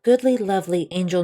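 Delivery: ordinary delivery, nothing marked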